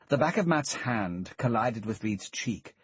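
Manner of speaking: ordinary delivery, nothing marked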